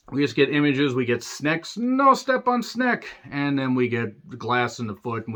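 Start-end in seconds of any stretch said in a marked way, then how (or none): 1.8-3.1 high, slow cartoonish squeak; silly meme voice. Reviewer quoting a snake meme, silly high voice. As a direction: squeak it slowly in a tiny voice.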